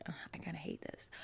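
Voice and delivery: quick, breathy whispered groan; a soft whispered admission of hating it; fed-up whisper. Retold or imitated. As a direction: mutter it under your breath, fast. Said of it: "I was like".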